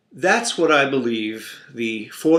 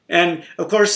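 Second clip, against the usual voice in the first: ordinary talk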